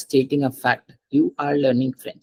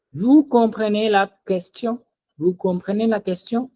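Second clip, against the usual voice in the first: "Vous comprenez la question?" higher, lifting on "question"; raised-voice question; 6 semitones higher than usual. Shown as a demonstration. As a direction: ask it by intonation alone. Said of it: "I am raising my voice".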